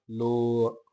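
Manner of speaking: ਲੋ with a lengthened o gliding to a; long vowel. Shown as a demonstration. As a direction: draw out ਲੋ into lo-a. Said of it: "ਇਹਨੂੰ ਲੰਮਾ ਉਚਾਰਨ ਕਰਦੇ ਹਾਂ".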